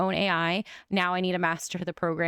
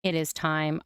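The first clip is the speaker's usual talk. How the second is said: normal talking voice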